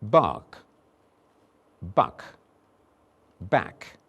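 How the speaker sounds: bark, buck, back said slowly and separately; minimal pair drill. Shown as a demonstration. Teacher drilling vowels for the class. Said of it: "Here they are again".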